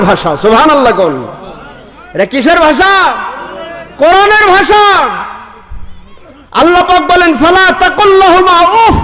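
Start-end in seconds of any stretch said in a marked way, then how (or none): 2.1-9.0 high, ringing sermon cry; fiery preaching. Acted. Preacher calling to the congregation, fiery and high. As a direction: preach it out to the crowd at full force. Preacher rises to full force.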